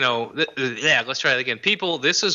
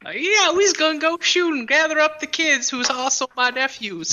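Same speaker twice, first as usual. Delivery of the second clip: hillbilly voice; 13 semitones higher than usual. Retold or imitated. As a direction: ham up a yokel from the hills. Joking about redneck Cletus.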